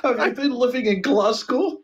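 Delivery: comic Russian-Scottish muddle; accent slipping into Scottish. Acted. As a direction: let the Russian accent drift to Glasgow. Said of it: "I've gone Scottish now too".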